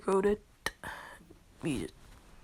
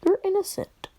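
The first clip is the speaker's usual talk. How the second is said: much higher, squeaky-high delivery; awkward repeat; 12 semitones higher, 3 dB louder than usual. Acted. Visitors repeat the news after he doubts it, awkward high voice. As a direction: say it with the pitch jumping right up.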